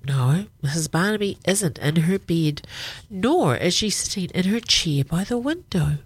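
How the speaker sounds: usual speaking voice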